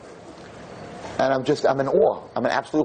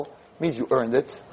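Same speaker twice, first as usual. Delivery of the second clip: usual speaking voice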